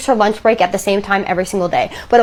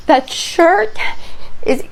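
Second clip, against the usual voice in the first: acted fear, high and slow; petrified whimpering; 4 semitones higher, slower than usual. Petrified husband telling his wife about the shirt, whimpering. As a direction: whimper it haltingly like a terrified child. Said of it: "absolutely petrified and whimpering like a child".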